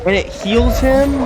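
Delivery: high, loud, slow shocked question; outraged disbelief. Real, not acted. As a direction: yell it in stunned disbelief. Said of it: "Sorry if I'm yelling".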